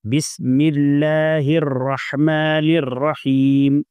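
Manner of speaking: slow, drawn-out melodic recitation; Quran recitation. Shown as a demonstration. Teacher reciting to students, slow and melodic. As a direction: recite each word long and measured.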